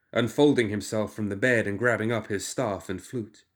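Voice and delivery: ordinary delivery, nothing marked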